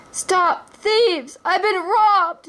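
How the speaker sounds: high, frantic yell, slow on the last words; furious yell. Acted. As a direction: howl after the thief. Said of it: "yelled the furious prince".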